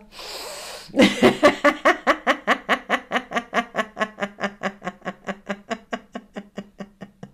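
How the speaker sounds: long helpless giggle fit; on laughing gas. Pretend. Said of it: "le rire du gaz hilarant".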